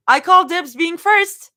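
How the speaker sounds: high, loud and chirpy. Acted. Zoe claiming first turn at bowling, chirpy. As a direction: chirp it out brightly. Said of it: "she chirped".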